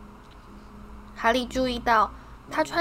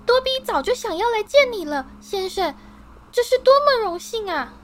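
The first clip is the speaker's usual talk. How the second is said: very high, louder elf voice bursting with honour; eager house-elf Dobby; 12 semitones higher, 5 dB louder than usual. A narrator's reading. Dobby thrilled to finally meet Harry, high squeaky voice. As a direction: squeak it out, overjoyed.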